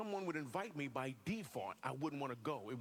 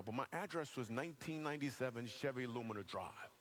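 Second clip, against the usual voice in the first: normal talking voice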